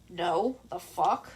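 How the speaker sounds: low, slow, deadpan retort; a mildly voiced irritated teen; annoyed Katsuki.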